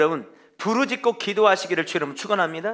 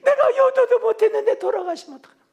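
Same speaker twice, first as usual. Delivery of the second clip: high, half-laughing wail of regret; mock wailing lament; 14 semitones higher, 4 dB louder than usual. Preacher mocks a neglectful child wailing at the funeral. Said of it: "그런데도 뭐라 하냐면".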